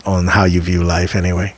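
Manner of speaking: ordinary delivery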